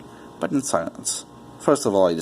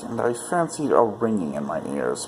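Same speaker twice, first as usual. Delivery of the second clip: ordinary delivery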